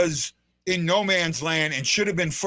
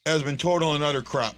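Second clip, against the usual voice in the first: usual speaking voice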